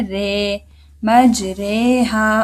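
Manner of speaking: रे said between रा and री; मजरे हा with the रे drawn out slowly; रे with imala. Shown as a demonstration. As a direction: lean the vowel of रे toward री. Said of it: "इसको क्या पढ़ना है".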